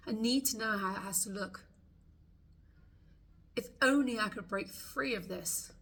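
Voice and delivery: voicing the frustrated client's thoughts for tapping along; tapping script voice. Coach speaks the listener's frustrations aloud, heartfelt tapping script.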